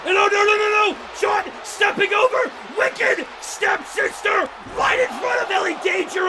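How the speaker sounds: high, urgent play-by-play; alarmed call. Real, not acted. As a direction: cry out as the danger unfolds. Commentator alarmed at new threat.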